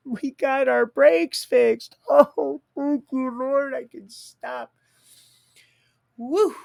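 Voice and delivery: high, loud squeals of relief; overjoyed. Real, not acted. Man celebrating his brakes being fixed, overjoyed. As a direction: burst out thanking the Lord.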